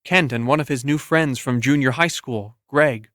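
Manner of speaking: usual speaking voice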